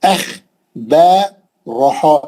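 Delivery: ordinary delivery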